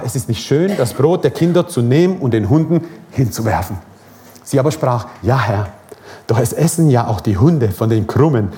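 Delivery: preacher reading the Gospel to his church; scripture reading. Acted. Reads the Bible passage.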